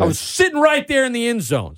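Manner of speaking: high-pitched exasperated rant; getting mad. Real, not acted. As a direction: relive the loss with rising outrage. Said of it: "Sorry, I'm getting mad thinking about it".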